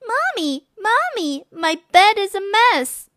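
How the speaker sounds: put-on a child running to tell her mother; excited girl. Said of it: "Shelley ran downstairs and said".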